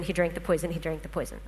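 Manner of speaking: usual speaking voice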